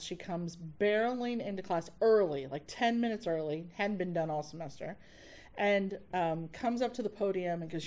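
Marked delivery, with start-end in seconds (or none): none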